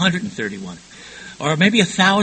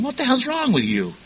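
exasperated scolding; 10 semitones higher than usual. Retold or imitated. Cop scolding a husband after arrest, exasperated. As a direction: pitch leaping up in disbelief. Cops scolding him, retold.

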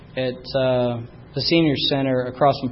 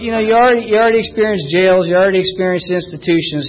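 raised, insistent counselor's warning; emphatic counselor; 5 semitones higher, 7 dB louder, faster than usual. Retold or imitated. Counselor warning a young addict leaving treatment, loud and insistent. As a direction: hammer it home like a stern counselor. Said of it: "he looked at me and he said, he goes".

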